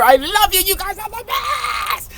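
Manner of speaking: high, gushy thanks; gushing affection. Real, not acted. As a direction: squeal the love at the fans.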